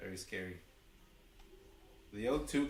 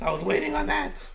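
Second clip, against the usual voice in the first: much higher and louder, bursting; excited yell; 11 dB louder than usual. Real, not acted. Streamer celebrating the long-awaited out, excited yell. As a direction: let the relief explode out.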